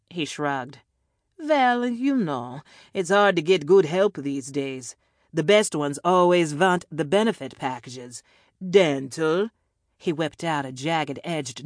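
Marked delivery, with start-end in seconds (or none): 1.4-9.5 put-on louder European-accented villain griping about staff; comic villain small talk in a European accent; hard-to-place European accent. A narrator's reading. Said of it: "a hard-to-place European accent".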